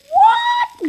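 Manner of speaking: playful rising what; mock-surprised question; excited what.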